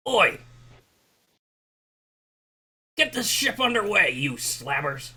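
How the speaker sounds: high, loud captain's holler, slowing on the insult; yelling ship's captain. Acted. Captain ordering the crew to get the ship underway, yelling. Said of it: "So the captain yells".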